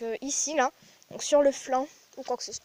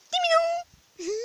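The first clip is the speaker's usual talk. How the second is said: much higher, slow sing-song coo; 6 dB louder, slower than usual. Real, not acted. Cooing high and slow to greet the cat.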